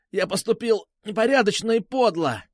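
high-pitched wailing repetition; forced confession. A narrator's reading. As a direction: cry out the dictated words. Captive repeats under pain. (выкрикнуть признание под нажимом)

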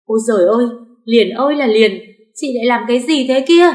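loud, high wail of a shocked mother-in-law; wailing shout. A narrator's reading. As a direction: cry out in alarm at the blood. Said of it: "gào lên".

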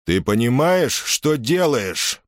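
high, slow, furious roar; angry roar. Acted. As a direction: roar it, red with rage. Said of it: "проревел Юлий, багровея от гнева".

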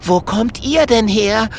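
high-pitched, astonished little dragon; delighted surprise. Acted. Kokosnuss greets his unexpected rescuers, amazed. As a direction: pipe the question up high in amazement.

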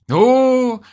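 high, indignant retort; offended grumble. A narrator's reading. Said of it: "буркнул ... обидевшись на новую обзывалку".

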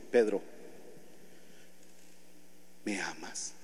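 low, soft and tender; Jesus gently asking Peter if he loves him. Acted. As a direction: ask with quiet love. Jesus asks again tenderly.

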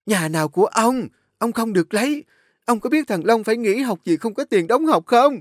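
high, loud angry shouting; wife yelling about son's school fees; furious wife. Acted. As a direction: confront him at full voice. Wife confronts husband.

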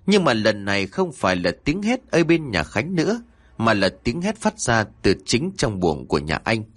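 usual speaking voice

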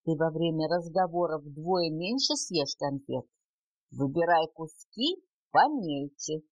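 plain reading voice

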